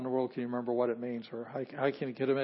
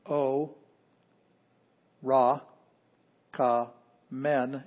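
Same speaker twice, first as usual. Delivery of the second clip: "o, Ra, Ka, Men" as isolated beats with long pauses; syllable by syllable; much slower than usual. Shown as a demonstration. Teacher dividing a Greek word for students, each syllable said separately. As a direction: break it up, one syllable at a time: "o, Ra, Ka, Men". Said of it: "there's a break".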